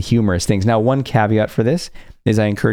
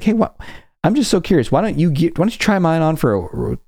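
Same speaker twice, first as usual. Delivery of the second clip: mock innocent curiosity; 8 semitones higher than usual. Retold coaxing stranger to swap.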